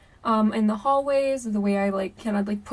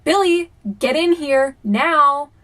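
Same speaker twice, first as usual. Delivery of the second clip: loud, higher, drawn-out annoyed summons; super annoyed; 5 semitones higher, 7 dB louder, slower than usual. Retold or imitated. Ghost mimicking sister calling brother, super annoyed. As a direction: call him in slowly and loudly, fed up. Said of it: "it was super annoyed sounding".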